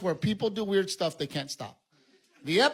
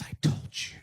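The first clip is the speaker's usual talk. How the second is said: breathless smug whisper; whispered told-you-so; 4 dB quieter than usual. Retold or imitated. Wife whispering to husband she was right, smug. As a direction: hiss it as vindicated wife. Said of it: "And my wife goes".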